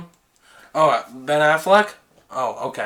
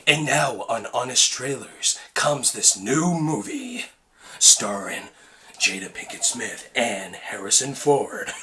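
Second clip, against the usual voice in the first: epic voice guy; 5 semitones lower, 8 dB quieter than usual. Retold or imitated. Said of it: "Epic voice guy?".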